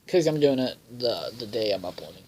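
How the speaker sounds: ordinary talk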